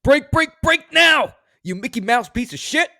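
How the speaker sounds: furious shout. Acted. Host reads angry car quote.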